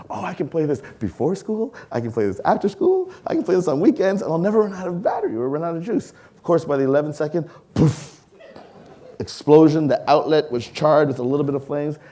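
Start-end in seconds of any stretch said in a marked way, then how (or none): 0.1-6.1 put-on higher, gushing with childish glee; excited kid. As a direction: rattle off the plans like a thrilled kid. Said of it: "I was so excited".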